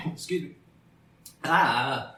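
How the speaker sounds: coughing on a swallowed fly; just swallowed a bug. Real, not acted. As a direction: splutter with a bug in your throat. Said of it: "I think I swallowed a fly".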